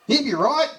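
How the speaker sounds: high, loud, breezy mock shout; carefree shout. Harrow shrugs off the worry with a high carefree shout. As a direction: toss it off like it's no worry.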